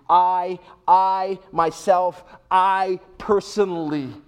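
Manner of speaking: loud, hammered I, I, myself, I personally; emphatic I. Acted. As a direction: stress every I hard and slow down on personally. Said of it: "emphatically in Greek".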